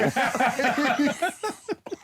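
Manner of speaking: high-pitched, drawn-out laughter. Real, not acted. Comic landing his story's punchline, high laughter. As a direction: burst into a long, high laugh.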